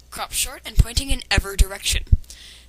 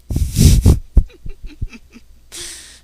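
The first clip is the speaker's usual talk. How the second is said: bursting out laughing, high and loud; a real laugh that cracks out at the line; loud laugh at the awful writing; 8 semitones higher, 7 dB louder than usual. Real, not acted. Laughs at the fanfic's wording.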